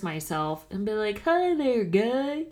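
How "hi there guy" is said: high-pitched, put-on hearty hello; overfriendly mock greeting, pitched up; awkward friendly greeting. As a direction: greet someone whose name you've forgotten. Acting out an embarrassing greeting.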